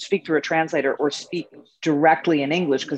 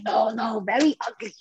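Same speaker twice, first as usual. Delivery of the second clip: imitating Ketut; 5 semitones higher than usual. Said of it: "And he would say".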